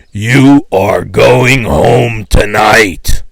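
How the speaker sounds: very slow, loud, solemn declaration; slow solemn pronouncement. Retold or imitated. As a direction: pronounce it like a prophecy. Giant cop's prophecy.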